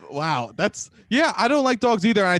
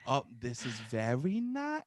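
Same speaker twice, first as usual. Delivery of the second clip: slow, soft go at a Midwestern accent; half-hearted Midwestern accent; attempted Midwestern accent; 5 semitones lower, 10 dB quieter than usual. Shown as a demonstration. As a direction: try Midwestern again, low and drawn out. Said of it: "Can you do a Midwestern accent".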